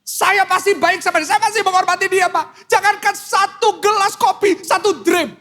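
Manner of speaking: high-pitched wife pleading her case; complaining wife. Acted. Wife telling the pastor about her husband, high-pitched complaint.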